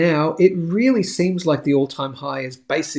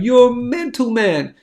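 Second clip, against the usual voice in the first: a higher, louder mock jeer; mocking Flare fans; 7 semitones higher, 4 dB louder, slower than usual. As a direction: toss off a scoffing fan's retort. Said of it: "Flare heads are going".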